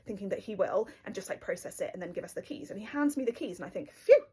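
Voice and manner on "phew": loud burst of relief on an exhale; relieved phew. Retold or imitated. Given the car keys at last, loud relieved phew. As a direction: let the tension out at once.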